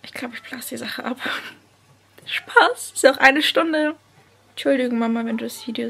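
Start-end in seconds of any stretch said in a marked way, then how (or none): 0.1-1.2 whispered mock confession. Real, not acted. Vlogger whispering a joke about calling it off. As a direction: whisper it like a secret.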